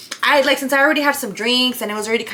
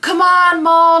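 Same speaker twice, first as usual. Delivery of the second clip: loud high pleading; begging; 6 semitones higher, 5 dB louder, slower than usual. Retold or imitated. A mild mock of the date begging her from the car. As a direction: beg her to come back. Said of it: "the whole way he's begging me".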